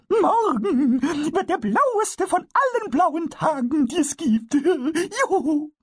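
very high, slow, laughing cheer; a tiny goblin bursting with joy; jubilant kobold. Acted. Pumuckl cheers to Eder about tomorrow, very high and laughing. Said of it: "so dass er jubelte".

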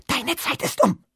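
high, loud hissing snarl; hissed. Acted. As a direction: hiss it out like a monster. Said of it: "fauchte sie".

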